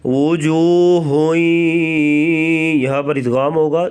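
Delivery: slow chanted وجوہ with rounded lips on واو; Quran recitation demo. Shown as a demonstration. Teacher reciting وجوہ for students with rounded lips. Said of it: "واو کو پڑھتے وقت ہونٹ گول کریں گے".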